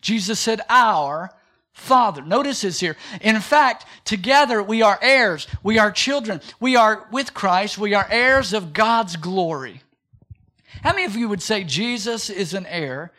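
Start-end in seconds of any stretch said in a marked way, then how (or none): 0.7-2.2 "Our Father" drawn out slowly and loud; slow emphasis. Acted. Slow stressed quote. 3.2-9.8 loud, slowed scripture proclamation; preacher proclaiming to the church; preaching at force. Acted.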